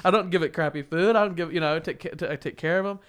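high, loud indignant rant; mock angry outburst. The offended owner ranting at the clerk in her head, high and loud. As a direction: vent like an offended dog owner. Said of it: "I was so mad".

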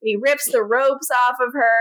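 ordinary talk

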